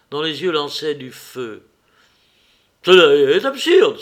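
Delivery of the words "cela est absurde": loud, furious cry of absurde; angry outcry. A narrator's reading. Furious little man rejecting the stranger's claim, shouting. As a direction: spit it out like an insult. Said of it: "s'écria-t-il".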